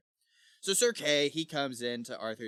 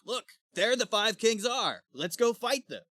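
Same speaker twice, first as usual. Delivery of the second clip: high, loud, mock-heroic call; eager battle cry; 10 semitones higher, 4 dB louder than usual. Sir Kay spots five kings and rallies companions, eager cry. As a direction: call out higher and louder, rallying them. Acting Kay spotting the enemy.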